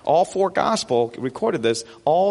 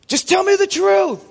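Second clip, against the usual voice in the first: high, loud movie-courtroom demand; Tom Cruise outburst; 14 semitones higher, 4 dB louder than usual. Acted. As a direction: cry it out like a dramatic film confrontation. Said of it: "Tom Cruise says".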